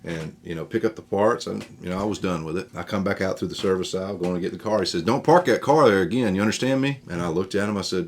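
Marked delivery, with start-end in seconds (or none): 5.0-7.0 loud, higher barked warning; angry scolding. Retold or imitated. Service man warning a teen off his spot, loud and angry. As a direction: lay down the law at a kid.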